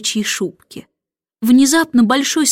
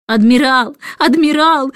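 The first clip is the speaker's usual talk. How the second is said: louder, indignant cry of a fussy lady; cross protest; 3 semitones higher, 4 dB louder than usual. A narrator's reading. Miss Lark scolding the admiral, angry and loud. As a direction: scold him sharply, a little higher. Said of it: "рассердилась мисс Ларк".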